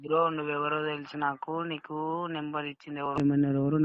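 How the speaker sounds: normal talking voice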